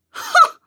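high shocked huh; shocked gasp. Acted. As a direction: gasp in outrage. Shocked at lewd remark.

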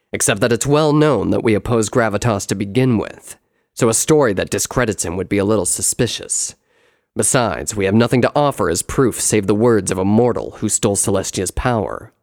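loud, firm brother's voice; full-voiced story character, assured; reasoning brother. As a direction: argue it forcefully as the elder brother. Brother reasons with his sisters.

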